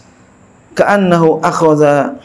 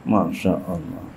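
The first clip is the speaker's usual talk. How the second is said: low soft Masya Allah, pitched well down; a sigh of amazement, sunk low and soft; hushed awe; 9 semitones lower, 11 dB quieter than usual. Acted. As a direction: breathe it out in wonder. Preacher marvels at the story.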